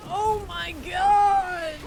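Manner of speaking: shocked outcry. Real, not acted. Shocked at a gross monster.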